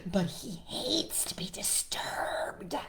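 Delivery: slow, hushed, breath-filled protest; hammed-up dread; gasped warning. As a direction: warn it fearfully, dragging out the last word. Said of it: "gasped all the animals".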